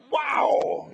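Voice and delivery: a real shout of excitement; excited wow. Real, not acted. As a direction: burst out in delight. Exclaiming at the insight.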